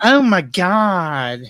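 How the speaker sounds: put-on drawn out, slow Valley Girl "oh my God".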